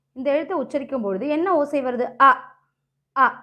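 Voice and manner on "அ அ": அ cut short, each alone; a quick, clipped அ; short vowel. Shown as a demonstration. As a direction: say அ briefly, then stop. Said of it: "குறுகிய ஓசை".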